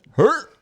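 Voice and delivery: sudden high strangled engine sound; mock engine choke; engine seizing noise. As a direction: imitate the engine dying with a sharp squeal.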